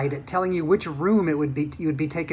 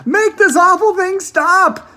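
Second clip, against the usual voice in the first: high, loud, slow command; ordered; 14 semitones higher, 12 dB louder, much slower than usual. A narrator's reading. Mister T V demanding Wonka stop the lift, loud and commanding. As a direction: bark the order in a shaken voice. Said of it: "ordered Mister T V".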